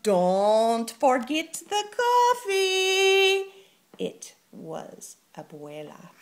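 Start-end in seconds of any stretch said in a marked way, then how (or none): 0.0-3.5 calling out. Acted. Grandmother calling from above.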